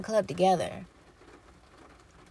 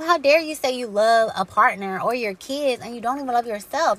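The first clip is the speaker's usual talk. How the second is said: ordinary talk, nothing marked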